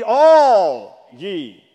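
"all" loud and long drawn out; booming, stretched emphasis on "all"; stress on ALL. Shown as a demonstration. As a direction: swell hard on "all", then drop for "ye". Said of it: "I'm gonna emphasize the word all".

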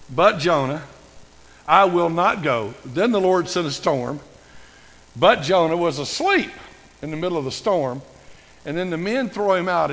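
loud, punched-out preaching phrases; forceful sermon retelling; emphatic preaching. Acted. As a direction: drive each phrase home from the pulpit, with breaks between. Preacher drives home the Jonah story.

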